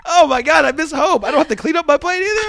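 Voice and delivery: high, loud, gushing reaction; hammed-up delight. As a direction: gush like a spoiled kid back home. Acting out joy at being home.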